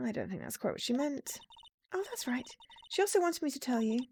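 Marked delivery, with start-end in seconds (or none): 1.9-4.0 put-on much higher-pitched girlish voice; innocently puzzled young girl; sweet, earnest girl character. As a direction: play a naive little helper.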